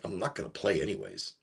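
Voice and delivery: put-on low, soft sulky child voice; sulky kid. The player refusing to go back in, sulky mumble. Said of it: "he's like".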